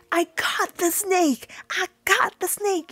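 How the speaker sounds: high, drawn-out triumphant cry, imitated; so happy. Claire after finally catching the snake, so happy. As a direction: crow it in a high voice, stretching the words. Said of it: "she's so happy".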